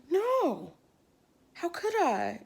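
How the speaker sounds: mock disbelief. Girl denying pregnancy to boyfriend, mock disbelief. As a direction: retell it as a high-pitched, incredulous protest. Acting out her denial to boyfriend.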